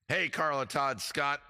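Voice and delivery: usual speaking voice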